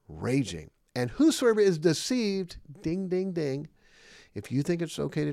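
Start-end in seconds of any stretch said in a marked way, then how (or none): 1.0-2.6 preaching at full force. Acted. Preacher builds to a peak. 2.8-3.7 mock bell ding. Game-show bell sound.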